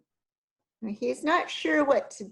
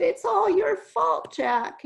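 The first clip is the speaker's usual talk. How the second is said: blaming schoolkids; 9 semitones higher than usual. Acted.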